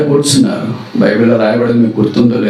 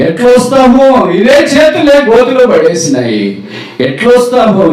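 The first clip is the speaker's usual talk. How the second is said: anguished outcry; 10 semitones higher, 4 dB louder than usual. Acted. Brothers' guilty outcry.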